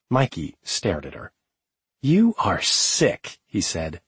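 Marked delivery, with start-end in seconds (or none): 2.0-3.4 disgusted. Acted. Disgusted at milk stunt.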